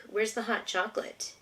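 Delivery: ordinary talk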